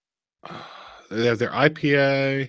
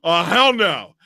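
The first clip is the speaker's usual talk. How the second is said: higher, louder retold outrage; outraged shout; 5 semitones higher, 6 dB louder, much slower than usual. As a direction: replay the moment you blew up. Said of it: "I mean, I am pissed".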